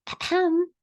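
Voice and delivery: high, polite throat-clearing "ahem"; polite cough. A narrator's reading. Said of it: "coughed politely".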